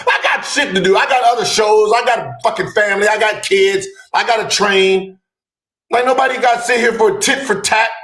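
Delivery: mock-frustrated tirade; exasperated rant. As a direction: vent like an overworked producer.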